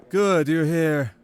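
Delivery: put-on a young royal, pleased, drawing it out; prince's voice.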